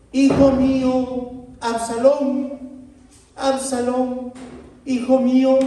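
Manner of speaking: king crying out for his son Absalón, loud and high; crying aloud. Acted. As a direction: call out the son's name in grief. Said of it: "clamaba en alta voz".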